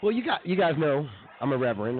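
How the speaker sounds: usual speaking voice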